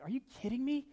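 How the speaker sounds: high, loud disbelief; high, amazed incredulity; incredulous. Retold or imitated. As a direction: play up how baffled you are. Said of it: "puzzlingly look at them and like".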